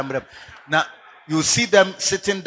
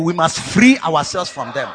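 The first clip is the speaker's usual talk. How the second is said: normal talking voice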